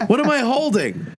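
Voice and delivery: high-pitched, drawn-out comic indignation; exasperated outburst. Retold or imitated. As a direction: protest like you're desperate for air, played for laughs. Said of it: "And I was like".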